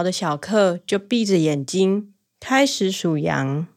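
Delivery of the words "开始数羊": usual speaking voice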